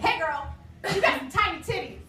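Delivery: a mock yelled catcall, hammed up; catcalling stranger. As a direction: lay on the street catcaller's sleazy lilt. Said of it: "just the way he said it".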